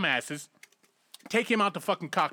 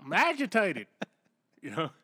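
higher-pitched, badly faked Southern yokel accent; 4 semitones higher than usual. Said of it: "That's a bad accent".

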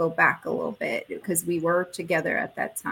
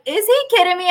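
high, loud disbelief; can't believe what she's seeing; incredulous; 11 semitones higher, 9 dB louder, faster than usual. Retold or imitated. Wife stunned by the real proposal, high loud disbelief.

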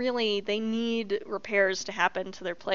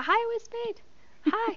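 high cheerful greeting; 12 semitones higher, 4 dB quieter than usual. Real, not acted.

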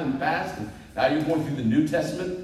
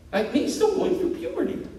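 squeaky cracking voice, laughing at himself. Real, not acted. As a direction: voice jumps way up high and cracks. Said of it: "my voice just keeps going up and down".

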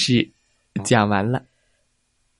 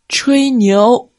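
mocking high voice; 12 semitones higher, 5 dB louder than usual. Animals mocking the fox's boast.

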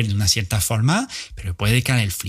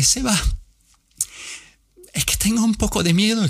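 put-on much higher, timid old lady; nervous friend; 8 semitones higher than usual. Elderly friend telling Seba she is scared, higher and timid. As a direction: confess the fear in a high, timid voice.

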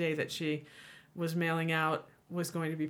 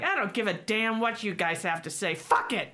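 loud, raised don't-care voice; imitated brash defiance; 4 semitones higher, 5 dB louder than usual. Lady waving off a language warning, imitated brash defiance.

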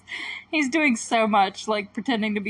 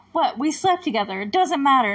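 lightly acted teenage protest; mock daughter-to-mother protest; retold arguing; 4 semitones higher, 4 dB louder than usual. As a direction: argue back, mildly exasperated. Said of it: "like, arguing".

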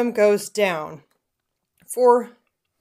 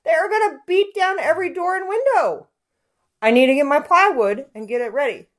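worked up; 9 semitones higher than usual. Retold or imitated. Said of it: "I'm like".

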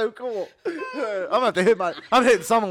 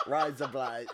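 sounds Australian; 9 semitones lower, 10 dB quieter, slower than usual. Shown as a demonstration. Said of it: "that's so weird".

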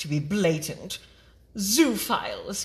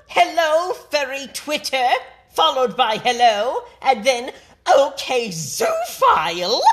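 mocking sing-song; 7 semitones higher, 7 dB louder than usual. Mocking reading of the tweets.

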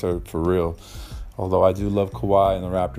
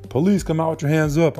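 loud, higher barked command from the cops; shouted police order; 8 semitones higher, 4 dB louder than usual. Retold or imitated. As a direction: yell it like a cop at the door. Cops banging on door.

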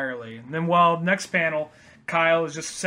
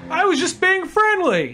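much higher, louder mock-innocent whine; 14 semitones higher, 5 dB louder than usual. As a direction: squeak it out all innocent. Kyle's innocent excuse.

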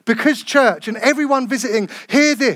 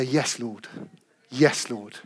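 soft answer; 9 semitones lower, 8 dB quieter, much slower than usual. Acted. The baptized answer softly.

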